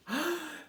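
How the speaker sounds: high sudden gasp; excited intake of breath; sharp gasp. Acted. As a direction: gasp at the find. Gasp of discovery.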